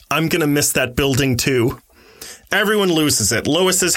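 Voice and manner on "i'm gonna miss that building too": ordinary delivery, nothing marked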